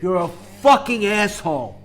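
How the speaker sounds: loud furious tirade at YouTubers; real rage. Real, not acted. Yelling at lying finance YouTubers, real furious rage. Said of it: "I'm sorry, I wasn't gonna be this way".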